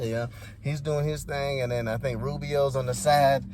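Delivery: ordinary delivery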